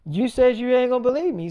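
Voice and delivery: high, loud comeback; acted retort. Daughter refusing to explain to mother, acted heated retort. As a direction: throw it back like an aggrieved daughter.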